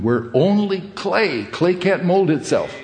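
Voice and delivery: ordinary delivery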